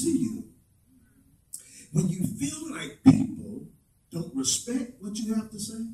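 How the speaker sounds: hushed sermon reflection; soft preaching. Acted. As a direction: pull the room in close. Soft intimate sermon stretch.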